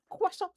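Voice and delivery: CROISSANT very high and loud, mock French; silly croissant. Shown as a demonstration. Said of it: "the silly way of just saying".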